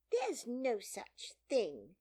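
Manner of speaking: high, loud, slow contradiction; cross, emphatic outburst; very angry. A narrator's reading. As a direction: object indignantly, stressing each word. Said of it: "beginning very angrily".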